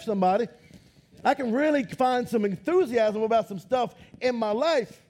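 normal talking voice

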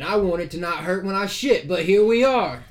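put-on loud grumpy old man; old prospector. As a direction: crank it up and grumble loudly. Old mapmaker snaps back.

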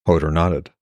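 steady storyteller reading aloud; calm novel narration; story narration. A narrator's reading. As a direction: read the chapter like an audiobook. Author reads novel aloud.

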